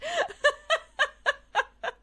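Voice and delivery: real high laugh; laughter bursting out; high laughing fit. Real, not acted. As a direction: laugh hard at the punchline. Laughing at own story.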